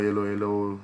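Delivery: normal talking voice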